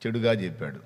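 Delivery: ordinary talk, nothing marked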